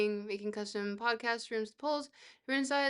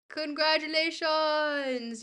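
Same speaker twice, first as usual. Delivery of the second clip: higher, louder, drawn-out congratulations; mock prize announcement; 4 semitones higher, 6 dB louder, much slower than usual. Streamer awards a viewer an emote, drawn-out announcer cheer. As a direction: announce the prize slowly, with fanfare.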